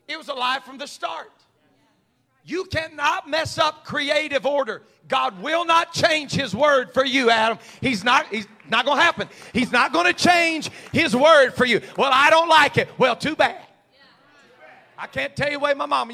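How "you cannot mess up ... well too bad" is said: preaching shout. Real, not acted. Preacher shouting God's refusal at Adam. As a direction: thunder it at the congregation.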